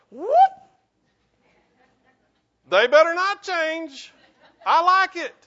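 loud and high, mock-indignant refusal of change; stubborn protest. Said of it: "how many of you went".

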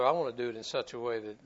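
ordinary delivery

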